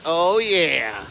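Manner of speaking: lightly voiced smitten gator, drawn-out falling tone; admiring gator. Imagined gators admire his look, lightly voiced approval. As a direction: play an approving alligator, mildly put-on.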